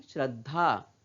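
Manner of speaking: श्रद्धा as 'shrad-dhaa' with a held द; half consonant. Shown as a demonstration. Teacher modelling the word for children, श्रद्धा as 'shrad-dhaa'. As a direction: stop on the half द, then release धा. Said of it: "क्या उच्चारण करते हैं?".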